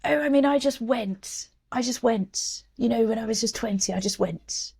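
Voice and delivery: high, slow, breezy mock RP; posh RP accent. Imitating privileged foreign correspondents in interviews, mock RP accent. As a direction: lightly send up a privileged reporter. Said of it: "so many times they would say".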